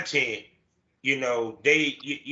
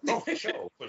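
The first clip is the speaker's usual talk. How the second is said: eager agreement; 13 semitones higher, 5 dB quieter than usual. Real, not acted. Host eagerly accepting invitation to hang out. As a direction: say it gladly, reaching up.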